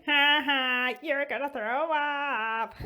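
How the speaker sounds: high, drawn-out singsong taunt; Shuri, mocking laughter. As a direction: tease him in a slow high voice. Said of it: "Shuri's in the back laughing at him".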